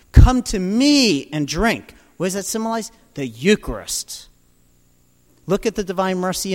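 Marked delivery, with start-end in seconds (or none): 0.1-1.9 loud, slow invitation; preaching call. Real, not acted. Preacher urging listeners to come, loud. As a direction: call them to come and drink. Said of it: "preacher's call".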